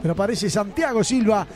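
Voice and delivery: play-by-play. Real, not acted. Live play-by-play.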